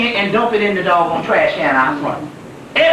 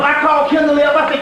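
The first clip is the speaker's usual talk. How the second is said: ordinary delivery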